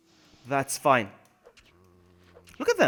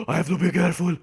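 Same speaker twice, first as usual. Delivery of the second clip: loud, hammed-up fretting; exaggerated worried cry; mock panic; 3 semitones higher, 5 dB louder than usual. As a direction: wail it in put-on alarm. Hammed-up panic before serious restatement.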